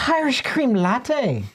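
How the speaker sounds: hammed-up Irish accent, slow. Shown as a demonstration. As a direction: lay the Irish lilt on thick, drawing it out. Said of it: "I feel like he should pronounce it like this".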